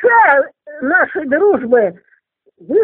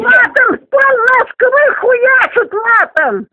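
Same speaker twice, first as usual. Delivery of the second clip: foul-mouthed granny; 7 semitones higher than usual. Real, not acted. Upset old woman defending swearing. (ругань сквозь слёзы со срывом на крик)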